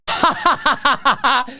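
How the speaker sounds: high, rapid-fire fake laugh; forced staccato ha-ha-ha laughing; mock laughter. Mocking the interviewed politician's answer.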